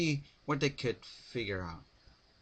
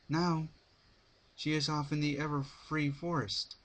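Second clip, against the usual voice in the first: put-on higher, worried young mare; regretful Twilight; 7 semitones higher than usual. A narrator's reading. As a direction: pitch up, a touch of regret. Twilight continues.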